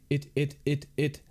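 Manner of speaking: catching on the word it, repeating it; agitated stutter. A narrator's reading. Floyd stuttering to Chase when agitated, stammering. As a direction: stammer it out, stuck on it. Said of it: "Floyd's stutter had improved".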